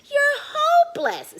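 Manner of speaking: high loud jeering voice; mock him from above; mean Little Rectangle. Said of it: "says Little Rectangle".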